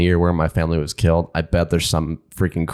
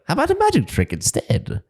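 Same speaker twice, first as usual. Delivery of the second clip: put-on high, creaky elderly voice; very old man. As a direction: play a frail old man. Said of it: "in my old man voice".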